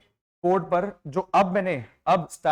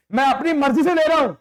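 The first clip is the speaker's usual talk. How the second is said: 1 mock bossy teacher; 10 semitones higher, 8 dB louder, faster than usual. Teacher choosing kids himself.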